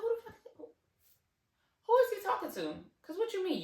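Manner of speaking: high-pitched, baffled reaction; high "who, me?" disbelief; incredulous. Retold or imitated. Acts her baffled reaction to calls.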